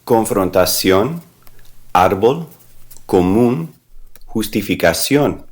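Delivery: con-fron-ta-CIÓN, ÁR-bol, co-MÚN, jus-ti-fi-ca-CIÓN, one at a time; slow word list, stressing each accented syllable of "Confrontación, árbol, común, justificación"; stress on accent mark. Shown as a demonstration. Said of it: "where you're gonna put the stress".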